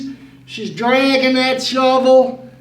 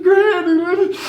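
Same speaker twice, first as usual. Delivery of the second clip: higher, tearful plea through sobs; crying child; 5 semitones higher, faster than usual. Retold or imitated. Boy confesses to Granny, crying and pleading. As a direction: blurt it out fast while crying. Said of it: "I'm just crying and crying".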